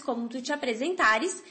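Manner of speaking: normal talking voice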